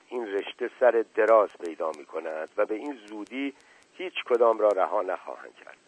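plain reading voice

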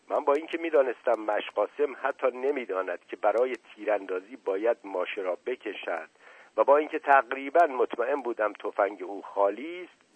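ordinary delivery

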